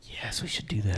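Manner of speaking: breathy whisper. Real, not acted. Quiet agreement to show the video.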